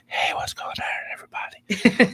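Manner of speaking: imitating her whisper. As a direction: whisper it like she can't wake anyone. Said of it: "She has to whisper".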